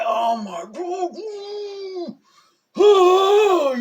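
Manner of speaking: slow, then high, loud pained ooh; cry of pain. Retold or imitated. Patient cries out as the tourniquet is twisted, pained wail.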